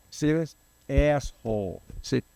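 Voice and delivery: lightly voiced muttered insult; mock insult. Imitating strangers insulting him, lightly put-on voice. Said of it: "they go like this".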